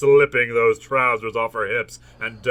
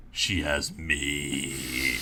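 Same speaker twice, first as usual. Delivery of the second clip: very deep, slow, soft declaration; hammed-up hero; 12 semitones lower, 11 dB quieter, much slower than usual. A narrator's reading. Hero claiming the lonely woman, hammed-up deep voice. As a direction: drop to a deep, drawn-out murmur. Hero's solemn vow, hammed by narrator.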